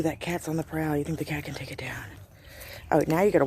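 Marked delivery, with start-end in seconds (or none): 0.0-2.0 breathless whisper while watching the cat. Real, not acted. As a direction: whisper so the bird won't hear. Watching cat stalk bird.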